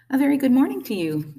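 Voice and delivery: high, loud cheery greeting; big friendly robot hail; friendly booming. A narrator's reading. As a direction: boom it out across a pond. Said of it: "the robot's friendly voice boomed".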